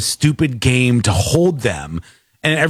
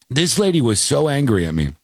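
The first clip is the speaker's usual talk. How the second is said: normal talking voice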